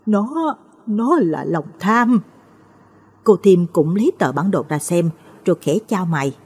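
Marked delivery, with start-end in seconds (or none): 0.1-2.2 breaking, slow, halting through sobs; halting words choked with grief; sobbing. Acted. Grieving mother sobs out the answer, slow and broken. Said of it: "nức nở".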